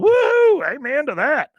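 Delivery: very high, loud hammed-up whoop; over-the-top acted jubilation. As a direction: holler it like a lecherous convert. Buck whoops at the hug.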